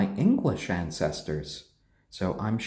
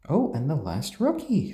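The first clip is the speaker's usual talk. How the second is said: normal talking voice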